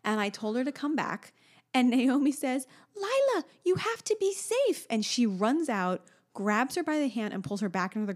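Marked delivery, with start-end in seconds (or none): 3.0-4.9 put-on high, slow earnest child voice; little girl voice. Mother voicing the friend warning Lila in the rain. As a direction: sound like a tiny girl being very responsible.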